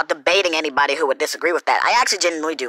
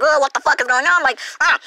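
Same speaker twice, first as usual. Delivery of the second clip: exasperated; 8 semitones higher than usual. Retold or imitated. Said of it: "I was like".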